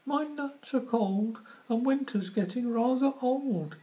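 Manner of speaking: very high, loud squirrel voice. Acted. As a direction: squeak it like a cold little squirrel. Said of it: "he says".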